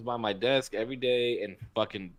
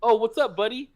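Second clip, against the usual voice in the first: raised, louder mock "what's up, buddy"; imitated corrected greeting; 8 semitones higher, 4 dB louder than usual. Retelling how he greeted the girlfriend's partner, imitated louder and higher. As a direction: mimic his greeting, pitched up and punchy. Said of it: "He was like, he was like".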